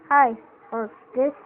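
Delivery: ordinary delivery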